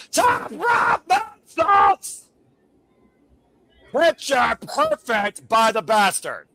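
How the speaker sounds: high, loud, slow shouted call; excited play-by-play. Real, not acted. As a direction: scream the big moment. Commentator calling a moonsault.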